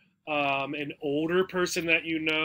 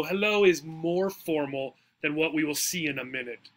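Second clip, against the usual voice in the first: ordinary talk, nothing marked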